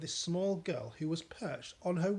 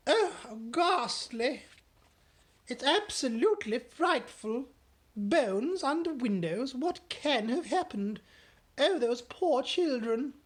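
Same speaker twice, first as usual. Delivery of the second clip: dismayed Queen; 9 semitones higher, 7 dB louder than usual. Acted. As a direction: pity the poor children aloud.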